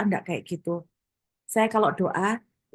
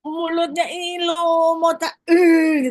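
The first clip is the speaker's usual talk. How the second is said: louder, higher, slowing into a strangled shout; furious venting; 5 semitones higher, 4 dB louder than usual. Retold or imitated. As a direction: let the rage spill over, wanting to strangle. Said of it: "izinkan aku meluapkan dulu amarahku".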